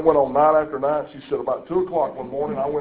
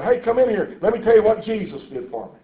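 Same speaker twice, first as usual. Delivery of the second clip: higher-pitched, imitated eager invitation; eager calling out; 6 semitones higher than usual. Dying patient calls passers-by in to hear about Jesus, eagerly. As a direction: call them in, pitched up.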